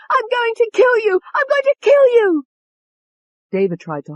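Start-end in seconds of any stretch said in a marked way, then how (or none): 0.1-2.4 murderous high-pitched scream; very high, loud frenzied rage. A narrator's reading. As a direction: scream the threat twice, rising in force.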